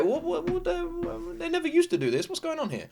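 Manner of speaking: a hint of growing suspicion; lightly acted inner confusion; suspicious confusion. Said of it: "And it's like".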